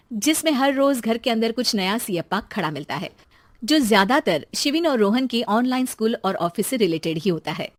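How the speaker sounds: usual speaking voice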